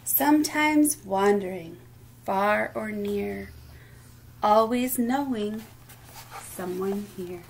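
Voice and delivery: sing-song picture-book reading, lilting rhythm; reading to children. Acted. Teacher reading rhyming book aloud at storytime, lilting. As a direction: read each line like a gentle rhyme for little ones.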